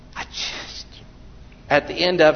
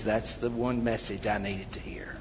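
whispered thanks; 6 semitones lower, 11 dB quieter than usual. Retold or imitated. Grateful listener quoted after the sermon.

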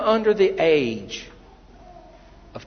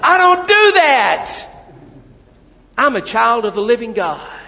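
full-force preaching; 11 semitones higher, 9 dB louder than usual. Real, not acted. Preacher shouting to the congregation at full force. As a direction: hammer the message home.

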